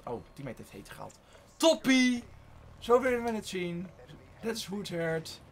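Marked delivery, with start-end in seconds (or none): 1.6-3.9 loud, high one-word cheer; cheering shout. Real, not acted. Gamer cheering on a teammate, cheering shout. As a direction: call out approval loudly.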